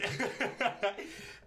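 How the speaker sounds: high burst of laughter; real, high-pitched giggling fit. Real, not acted. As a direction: crack up at your own attempt. Laughing at own accent attempt.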